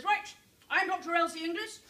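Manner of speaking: march in and state your name; a doctor introducing herself to the authorities, loud and self-assured; brisk doctor announcing herself. Retold or imitated. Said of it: "goes along to the authorities ... and says".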